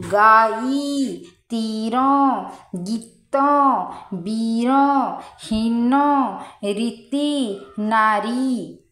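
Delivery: "रीति, नारी" slow, with a long held ee; long ee, stretched. Shown as a demonstration. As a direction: drag the ee out long in each word. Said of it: "ये सब पूरा लंबा खींच रहा है". (दीर्घ ई पूरा खींचकर पढ़ें)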